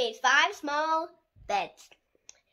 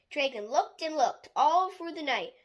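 ordinary delivery

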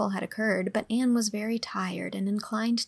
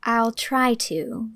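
slow, louder, strained reply; gasped, stifling laughter; 5 dB louder, much slower than usual. A narrator's reading. Teacher answers pompous mother, gasping back laughter. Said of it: "gasped Anne, choking back a wild desire to laugh".

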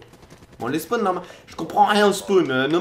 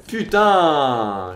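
usual speaking voice

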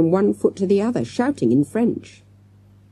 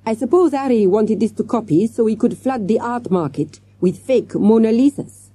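put-on higher-pitched French-accented inspector; French detective; 6 semitones higher than usual. A narrator's reading. Detective explaining forger's plan to teacher, theorising. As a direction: speculate as a French policeman.

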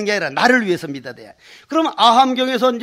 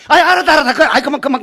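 higher, louder fed-up cry of giving in; exasperated shout; 5 semitones higher, 6 dB louder, much faster than usual. Retold or imitated. Worn-out Gyeongsang man shouts at the visitor to leave, exasperated. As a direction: throw up your hands and shout him away.

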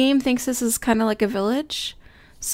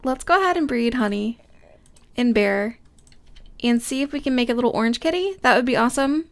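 ordinary talk, nothing marked

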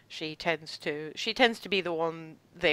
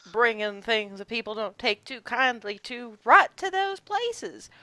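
country ranch woman, wry; 7 semitones higher, 8 dB louder than usual. Acted. GM voices Lisa.

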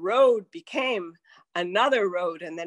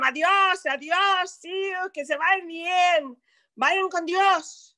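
family shouting adiós as the car leaves; shouted farewells; 8 semitones higher, slower than usual. Retold or imitated. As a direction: call after the departing car. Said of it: "they were shouting and waving".

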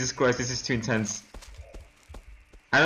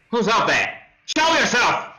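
raised, higher demand to someone unseen; calling out; 6 semitones higher than usual. Real, not acted. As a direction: call out a challenge into the dark. Calls out to unseen threat.

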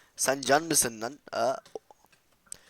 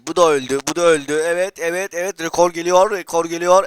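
loud, high triumphant yelling; cheering; 5 semitones higher, 11 dB louder than usual. Real, not acted. Gamer cheering his kill record to viewers, loud and high. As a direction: celebrate every kill out loud.

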